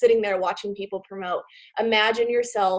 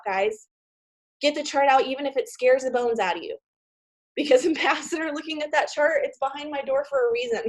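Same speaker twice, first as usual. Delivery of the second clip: ordinary delivery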